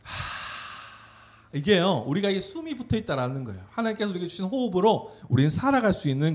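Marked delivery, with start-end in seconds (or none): 0.0-1.5 soft unvoiced exhale after a deep breath; breathing out slowly for the children; big breath out. Shown as a demonstration. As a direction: let the breath go with a soft haa. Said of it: "숨을 한번 크게 한번 들이마셔 볼게요".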